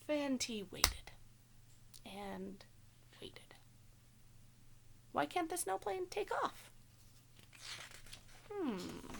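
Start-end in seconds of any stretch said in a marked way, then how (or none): none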